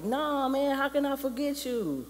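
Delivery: high-pitched, mock-pleading denial; protesting. Simba answers his father, high and protesting.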